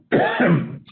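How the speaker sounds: short dry cough; real cough mid-sentence. Real, not acted. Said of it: "I'm coughing".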